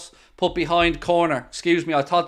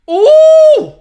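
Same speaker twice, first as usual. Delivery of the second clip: long, very high, loud "ooh"; loud startled "ooh"; 16 dB louder than usual. Real, not acted. Watchalong streamer sees a shot just miss, loud high ooh. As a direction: howl a drawn-out ooh at the near miss. Near miss during live match.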